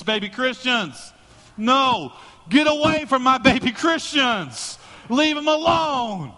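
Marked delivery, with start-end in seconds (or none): 1.6-6.3 loud, high, slow, fiery preaching; preaching at full force; protective preaching. Real, not acted. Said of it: "preaching fervor".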